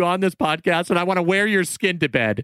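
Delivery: high, gushing fanboy plea. Pretend. Host pleading with Tom Cruise to join, gushing high. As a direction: gush at Tom like a starstruck fan.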